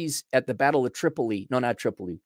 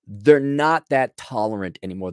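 normal talking voice

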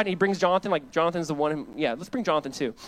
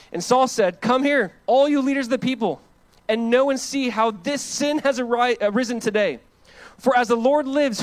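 raised, ringing preaching voice; loud scripture reading; 7 semitones higher, 5 dB louder than usual. Acted. Pastor reads Saul's story aloud to church, loud and ringing. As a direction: proclaim the verses to the congregation.

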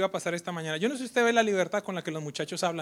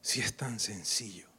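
soft slow whisper; 7 semitones lower, 7 dB quieter, slower than usual. Real, not acted. Pastor whispering the simple truth to church. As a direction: breathe it out slowly. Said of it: "preacher whispers point".

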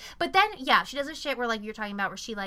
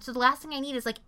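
ordinary talk

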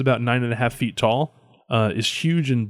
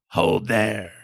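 alien creature; 3 semitones lower than usual. Acted.

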